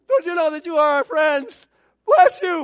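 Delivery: high, loud mock farewell shout; movie shout. Preacher mocking his own farewell as the movie hero, loud and high. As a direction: belt it out like the film scene. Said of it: "I feel like I'm in Dances with Wolves".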